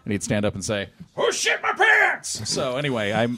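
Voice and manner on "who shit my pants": mock yell. Retold drunk man yelling.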